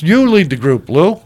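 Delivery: retold yell. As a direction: call out an order, imitating the boss. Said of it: "So Mitzi yells".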